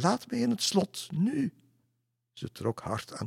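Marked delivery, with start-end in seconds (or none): 0.0-1.5 very high, insistent lady's cry. A narrator's reading. Lady on horseback demands to be let in, crying out. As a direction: cry it high, hammering the final word. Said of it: "riep ze uit".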